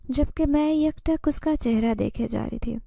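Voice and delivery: ordinary delivery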